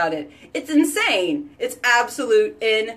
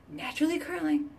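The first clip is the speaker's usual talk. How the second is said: soft awed repeat; 6 semitones higher, 9 dB quieter, faster than usual. Real, not acted. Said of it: "It's it naturally curling".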